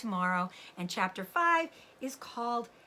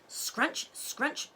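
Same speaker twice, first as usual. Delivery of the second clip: shovel noise; 7 semitones higher than usual. A narrator's reading. Shovel sound effect.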